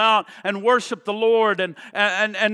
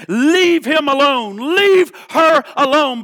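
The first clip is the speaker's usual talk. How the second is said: commanding rebuke; 6 semitones higher, 8 dB louder than usual. Acted. The audible voice of Jesus saying.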